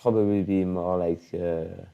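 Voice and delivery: halting attempt at an Israeli-like accent; Israeli-sounding accent. Shown as a demonstration. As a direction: feel for English words in an Israeli accent. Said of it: "That sounds Israeli".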